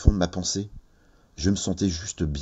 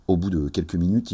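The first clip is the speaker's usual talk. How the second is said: plain reading voice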